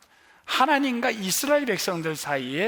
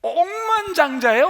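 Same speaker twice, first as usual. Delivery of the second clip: much higher, louder burst on 억만장자예요; emphatic exclaim; 11 semitones higher, 8 dB louder than usual. Real, not acted. Pastor telling the congregation about the billionaire, excited. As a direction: proclaim it in amazement. Stressing the man's vast wealth.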